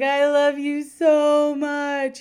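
put-on slow, loud, high gushing child voice; a kid hugging a dog and crooning at it; drawn-out doting little girl. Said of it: "she gets right up in his face and she's like".

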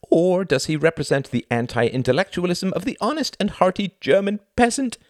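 high, showy stage-host voice; theatrical thin man. Acted. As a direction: play the pompous thin performer lecturing the audience. Thin man explains the metaphor.

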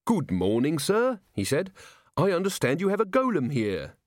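lightly voiced, polite policeman making inquiries; Carrot, polite watchman. A narrator's reading. Carrot calls on the timber merchant, politely official.

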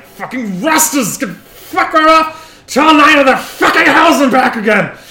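high, loud furious rant; wounded half-orc roaring in anger; pained cursing. Acted. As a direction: bellow oaths through the agony. Injured half-orc cursing at helpers.

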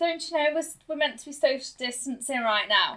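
indignant mum. Retold or imitated. Mum scolds a stranger in a queue, mock indignant. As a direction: huff at a stranger standing too close.

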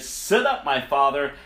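high, loud eager son asking for the blessing. Acted. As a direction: call out brightly to wake his father. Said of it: "Then he said".

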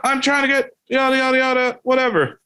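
high, rushed, offhand excuse voice; mock driver voice. Driver brushing off the kid, high rushed mock voice. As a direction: toss it off as the hurried driver.

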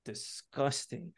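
low, drawn-out queasy moan; mock sick groan, very soft and slow. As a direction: groan it like you're about to throw up. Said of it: "And I was like".

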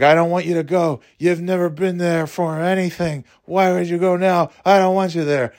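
high-pitched daughter voice; imitated upset daughter. Daughter tells alcoholic mom to stay away, mock upset. Daughter's side of a retold fight.

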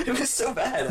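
giggling while admitting how bad it was; laughing. Real, not acted. Telling a friend the broken thumb story, laughing.

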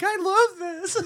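high, airy mock-delighted voice; mock girly delight. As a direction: squeal it like a smitten fangirl. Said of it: "and you're like".

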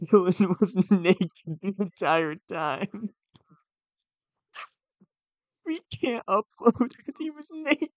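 high, put-on upset voice; dragged-out, high-pitched fake dismay; feigned distress. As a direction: sell a fake breakdown on a prank call. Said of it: "to really sell it up".

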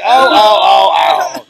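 loud re-enacted pretend moaning; faked climax moans. Shown as a demonstration. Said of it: "That was exactly how I said it".

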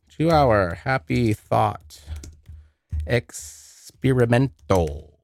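slow, drawn-out words while typing; halting, stretched-out search query; typing aloud. Real, not acted. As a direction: sound it out slowly as you type.

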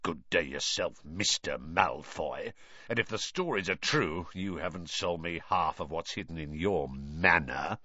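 low, soft, sour muttering; resentful grumble behind his back; oily manner dropped. A narrator's reading. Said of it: "dropped his oily manner".

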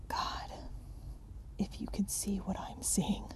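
trembling breathless whisper; shaky whisper. Acted. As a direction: whisper it, shaking with dread. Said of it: "called from below in a shaky whisper".

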